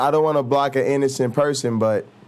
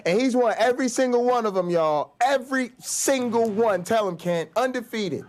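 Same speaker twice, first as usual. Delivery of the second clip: higher, hyped-up cheering for Kent; big hype-man praise, pitched way up; 9 semitones higher, 3 dB quieter than usual. Real, not acted. Host hyping guest Kent to chat, high cheering hype. Hyping Kent's debate record.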